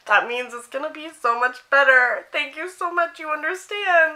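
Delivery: high-pitched, overcome fangirl thanks; mock emotional gushing. Re-enacting thanks to her idol for a photo, emotional and gushing. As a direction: gush gratefully, pitch climbing high. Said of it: "I got emotional".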